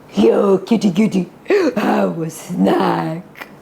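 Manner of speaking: low, slow coaxing wolf voice; a lightly voiced sneaky wolf; sly wolf. Shown as a demonstration. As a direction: lure the kitty in slowly. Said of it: "you're the big bad wolf".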